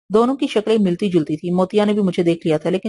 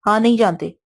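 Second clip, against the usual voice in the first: ordinary delivery, nothing marked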